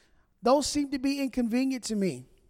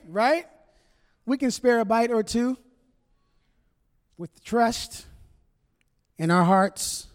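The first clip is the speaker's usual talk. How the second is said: normal talking voice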